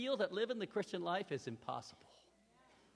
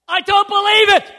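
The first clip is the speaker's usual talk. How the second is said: loud, much higher cry of disbelief; awestruck exclamation; 26 dB louder than usual. Retold or imitated. Luke watches the fighter lifted, loud and awestruck. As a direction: blurt it out in sheer amazement. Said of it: "Luke, in awe, he goes".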